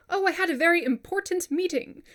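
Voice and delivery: stiff, over-careful reply with hard T's; mock overly proper answer; overpronounced T. Shown as a demonstration. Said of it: "we don't really talk like that".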